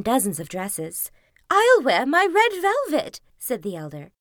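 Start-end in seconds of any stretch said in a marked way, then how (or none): 1.5-3.2 lightly voiced, high and proud; a vain older sister showing off; boastful stepsister. Stepsister boasting about her dress.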